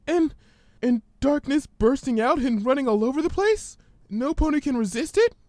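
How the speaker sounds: high, hesitant frightened pony; Fluttershy's voice. Acted. Fluttershy fearfully reciting the ominous words, timid and high.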